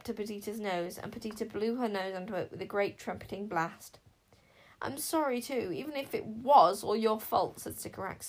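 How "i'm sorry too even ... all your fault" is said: grudging sympathy. A narrator's reading. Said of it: "sympathetic despite herself".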